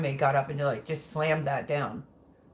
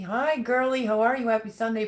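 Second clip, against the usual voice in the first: bright, higher greeting to a friend; cheerful greeting; 5 semitones higher, 4 dB louder than usual. Real, not acted. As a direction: greet her like a happy friend. Greeting viewers warmly.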